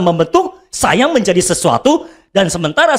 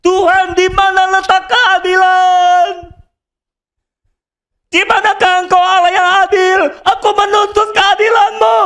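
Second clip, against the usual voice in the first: crying out to heaven; 9 semitones higher than usual. Retold or imitated. Believer crying to heaven for justice, anguished shout. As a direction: plead and demand justice from heaven. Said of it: "sedang berseru kepada sorga".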